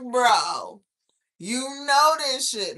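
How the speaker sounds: loud pretend scolding; mock yell. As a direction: yell it at him half joking. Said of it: "and it's like".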